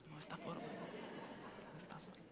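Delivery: plea fading away to a whisper. Retold or imitated. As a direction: trail off into a tiny, whispered prayer. Retold prayer fading to whisper.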